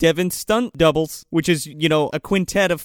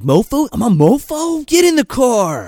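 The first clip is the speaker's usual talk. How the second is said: higher and louder, indignant at mofo; indignant shout; 9 semitones higher, 7 dB louder than usual. Retold or imitated. Josh shouting back at the drunk girl, indignant. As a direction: throw mofo back at her, offended and loud.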